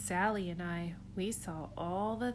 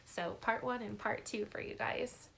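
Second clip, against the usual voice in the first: plain reading voice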